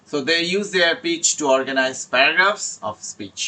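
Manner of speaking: slow, deliberate reading of a study tip; slow slide reading. Acted. Teacher reads the tip to IELTS students, slowly. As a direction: read it out word by word like a slide.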